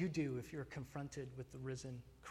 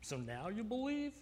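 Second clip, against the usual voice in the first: higher, sceptical "So now you believe?"; a hint of a scornful Jesus; mock sarcastic question; 6 semitones higher than usual. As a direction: play the rebuke he didn't give. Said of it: "Jesus isn't saying, like".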